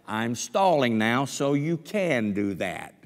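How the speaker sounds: usual speaking voice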